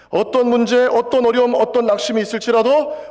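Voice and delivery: loud, high sermon build; fervent preaching. Acted. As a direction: press each phrase harder. Preaching at full force. (힘차게 고조되는 설교조)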